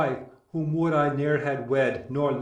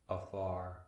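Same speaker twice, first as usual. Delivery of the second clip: afar almost gone, much softer and lower; fading to a breath; 7 semitones lower, 14 dB quieter than usual. Acted. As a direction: let the last afar die away. Admetus longs for his dead wife.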